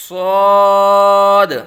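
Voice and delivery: صاد with a thick, rounded, heavy sound, long and loud; full-mouthed whistling Saad. Shown as a demonstration. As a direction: fill the mouth on صاد, keeping the s sharp like a whistle. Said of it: "پر یعنی موٹا کر کے پڑھیں گے".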